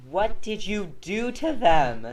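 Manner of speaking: high, slow, loud disbelief; baffled parent asking; exasperated adult. Retold or imitated. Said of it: "the adults are just like".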